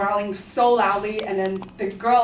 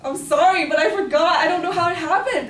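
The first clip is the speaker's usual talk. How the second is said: higher and louder, pleading in a rush; flustered apology; 8 semitones higher, 6 dB louder than usual. Retold or imitated. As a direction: caught unprepared, pleading with the teacher, high and loud. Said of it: "And I was like".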